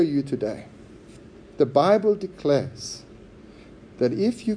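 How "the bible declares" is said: usual speaking voice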